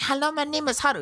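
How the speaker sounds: put-on very high little-kid voice; squeaky, high mock schoolboy; imitated first-grader. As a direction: mimic a kid proudly showing off. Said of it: "And then he's like".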